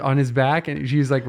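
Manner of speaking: normal talking voice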